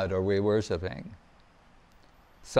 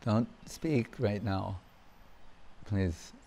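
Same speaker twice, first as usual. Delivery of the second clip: quiet aside; 5 semitones lower, 10 dB quieter than usual. Real, not acted. Aside hushing someone in audience.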